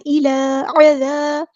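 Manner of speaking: slow, drawn-out chanted recitation; tajweed chant; Quranic recitation. Shown as a demonstration. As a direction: recite it melodically, stretching each sound. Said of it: "recites Quran verse in tajweed".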